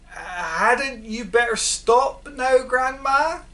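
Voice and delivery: lightly voiced boy, anxious; worried boy. A narrator's reading. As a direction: coax her to stop, anxious. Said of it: "George said".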